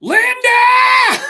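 very high, loud call; shouting for wife. Retold or imitated. Uncle calling for his wife, loud shout. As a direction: yell the name in shock. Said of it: "he just goes".

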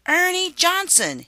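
high, loud insistent repeat of the name; repeated loudly. A narrator's reading. Wife repeating the slug's name to her husband, loud insistence. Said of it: "his wife repeated".

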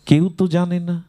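retold amazement. Mahaprabhu marvels that no one knows, acted as amazed. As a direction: act out astonishment that nobody knows. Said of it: "অবাক হয়ে মহাপ্রভু বলছেন".

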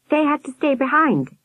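put-on high-pitched boy's voice; explaining boy. A narrator's reading. Albert carrying on explaining, high boy voice. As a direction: pitch it up like a child informant.

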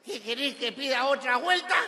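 put-on high, loud slurred-drunk question; put-on drunk's loud query; drunk driver. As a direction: shout back as a confused drunk. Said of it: "Y el borracho".

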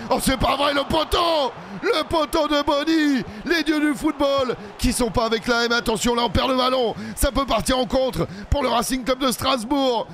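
high, shouted disbelief at the post; anguished fan commentary; frantic commentary. Real, not acted. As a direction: cry out as the ball hits the post. Shot hits the post.

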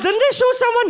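very high, loud worried question; alarmed mother. Retold or imitated. Mother asking about the club shooting, high mock alarm. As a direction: shriek it like a worried mom, hammed up. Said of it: "Your mother goes".

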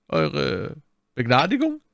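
wondering question, rising high; puzzled surprise. A narrator's reading. Richelieu hearing that D'Artagnan holds a pardon, surprised. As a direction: echo it slowly in wonder. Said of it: "fragte Richelieu verwundert".

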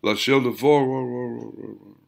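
very slow, drawn-out, far-off voice; slow echoing whisper from a distance; distant echo. A narrator's reading. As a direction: let it float in from far away. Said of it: "distant echoes of the same phrase".

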